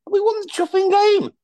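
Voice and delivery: indignant high burst; exasperated outburst. Real, not acted. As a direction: spit out the frustration at the win. Exasperated punchline.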